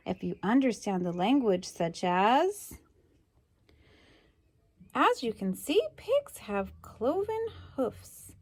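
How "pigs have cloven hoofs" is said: ordinary delivery, nothing marked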